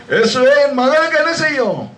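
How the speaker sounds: high, acted cry for help; pleading mother. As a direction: beg Jesus for your daughter, preacher-style. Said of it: "வந்து வேண்டிக் கொள்ளுகிறாள்". (மகளுக்காக மன்றாடும் தாயின் குரல்)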